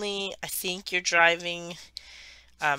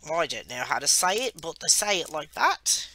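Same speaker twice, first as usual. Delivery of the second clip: Australian accent. Shown as a demonstration. Traveller showing how locals say it, Australian accent. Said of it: "I can get the Australian accent down".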